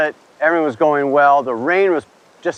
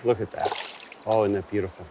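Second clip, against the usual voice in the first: hushed awe; 5 semitones lower, 10 dB quieter than usual. Real, not acted. Admiring fish softly.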